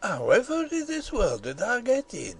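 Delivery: put-on swooping, baffled old gardener; overpowered by curiosity. A narrator's reading. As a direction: burst out asking in Yorkshire dialect. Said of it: "overpowered by curiosity".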